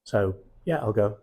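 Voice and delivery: normal talking voice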